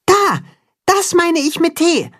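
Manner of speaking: very high, pointing it out eagerly; high child voice. A narrator's reading. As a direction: lift the voice way up like a kid. Max character voice.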